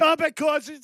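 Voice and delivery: high-pitched heated protest; heated yelling. Real, not acted. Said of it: "stop yelling and screaming".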